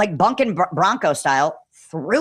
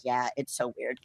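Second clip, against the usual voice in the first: muttered low and much softer; hushed agreement; 6 semitones lower, 11 dB quieter than usual. Retold or imitated. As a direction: murmur it like a quiet aside. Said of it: "And he's like".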